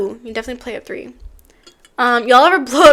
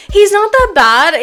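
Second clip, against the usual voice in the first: put-on southern accent; 7 semitones higher, 8 dB louder, slower than usual. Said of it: "giving him somewhat of a southern accent".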